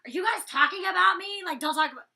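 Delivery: loud, high imitation of an accuser; mock paranoid accusation. Danny walking up on housemates talking, mock paranoid accusation. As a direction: butt in high and loud, mockingly.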